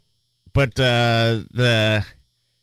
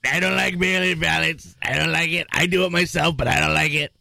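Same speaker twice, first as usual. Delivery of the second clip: higher-pitched mock politician impression; Donald Trump; 6 semitones higher than usual. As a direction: put on the voice, complaining in short repeated phrases. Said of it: "Donald Trump telling him".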